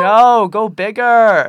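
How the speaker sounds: slow, high, loud cheer; loud encouragement. Retold or imitated. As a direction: cheer her on loudly. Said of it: "And we were like".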